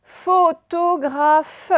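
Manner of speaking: mot très étiré, voix haute; détacher chaque syllabe pour la dictée; dictée lente. Shown as a demonstration. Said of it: "dictée mot lentement". (dicter lentement)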